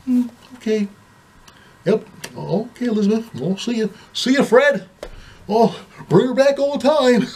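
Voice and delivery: high-pitched, timid pushover voice; mock meek husband. Husband seeing off his wife and her boyfriend, meekly agreeable. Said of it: "Very meek, very mild acting".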